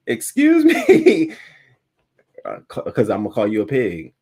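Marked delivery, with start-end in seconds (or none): 0.1-1.3 indignant excuse me. Retold or imitated. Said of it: "Now I'm over here".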